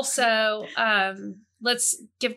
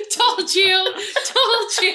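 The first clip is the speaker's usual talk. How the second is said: laughing gloat; 9 semitones higher, 4 dB louder, much slower than usual. Real, not acted.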